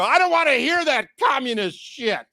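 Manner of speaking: hammed-up angry shout; mock furious rant. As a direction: yell it like an outraged passenger. Ranting at the driver.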